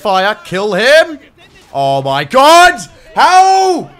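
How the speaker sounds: high, loud anguished yell; desperate shout. Real, not acted. Player yelling at his squad as the bomber hits, anguished shout. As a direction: scream it out as it all goes wrong.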